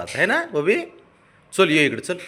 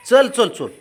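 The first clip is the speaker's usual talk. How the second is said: loud high shout of चल; shouted call; 10 semitones higher, 6 dB louder, slower than usual. Real, not acted. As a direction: shout him over. Calling pet loudly. (ओरडून हाक)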